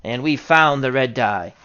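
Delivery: ordinary talk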